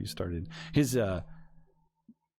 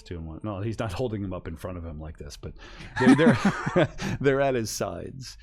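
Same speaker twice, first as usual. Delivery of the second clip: usual speaking voice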